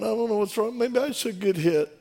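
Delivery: high, whiny imitation of crying; mildly hammed-up tearful self-pity; mock crying. Husband breaking down to the pastor, mock crying. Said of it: "oh, he starts crying".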